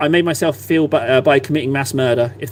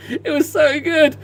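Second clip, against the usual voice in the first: laughing; 13 semitones higher, slower than usual. Real, not acted. Laughing at the memory.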